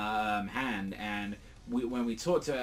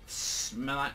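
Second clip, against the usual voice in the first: normal talking voice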